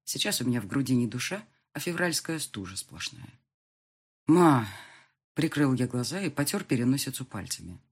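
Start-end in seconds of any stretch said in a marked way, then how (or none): 4.3-4.7 loud, pleading "Ma!"; exasperated outburst. Acted. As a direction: cut her off with a firm call. Son calls out to mother.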